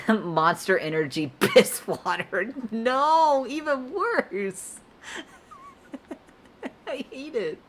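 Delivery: high-pitched, laughing through every word; can barely get words out for laughing; helpless laughter. Real, not acted. Streamer cracking up at chat's Monster Energy piss water, laughing high. Chat keeps posting cursed water.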